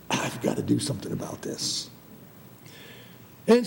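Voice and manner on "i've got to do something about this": low, hushed scheming voice; Satan. Retold or imitated. Satan plotting against the happy couple in Eden, low and hushed. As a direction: plot it under your breath, pitched right down. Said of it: "he came to the Garden of Eden and said".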